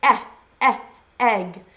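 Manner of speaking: short "Eh" said twice on its own, then "egg"; letter E sound. Shown as a demonstration. Teacher showing children the funny sound of E, sounding out "Eh, eh, egg". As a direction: separate each "eh" with a pause before "egg". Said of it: "makes sort of a funny sound".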